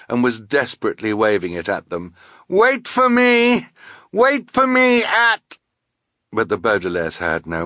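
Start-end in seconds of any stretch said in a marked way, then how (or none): 2.5-5.6 high, loud, drawn-out call; pleading yell from someone being swept away; desperate shout. A narrator's reading. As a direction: shout slowly to carry over rushing water. Swept away, shouting to his friends.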